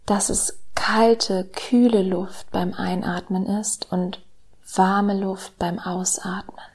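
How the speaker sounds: slow, soft meditation voice with whispered lines; calm guided meditation, hushed and slow; soft spa whisper. Acted.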